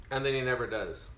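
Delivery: ordinary talk, nothing marked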